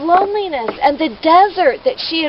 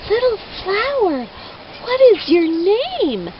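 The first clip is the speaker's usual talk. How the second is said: put-on higher, gentle girlish asking voice; lightly voiced young girl; 7 semitones higher than usual. Much Afraid asks the little flower its name, voice lifted higher. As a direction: lift the voice like a curious little girl.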